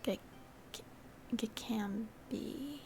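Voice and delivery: Gekambi drawn out slowly, softly; slow, quiet try at Gekambi; sounding it out. Shown as a demonstration. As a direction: test Gekambi piece by piece, rising at the end. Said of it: "is how you say the first part".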